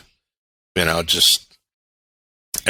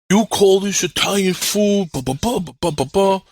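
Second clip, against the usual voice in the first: 1 angry Brooklyn customer; 10 semitones higher than usual. Retold or imitated. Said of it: "He's like".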